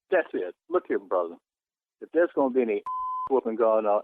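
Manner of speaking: usual speaking voice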